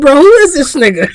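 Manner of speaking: cracking up mid-sentence; laughing. Real, not acted. Quoting himself laughing hard.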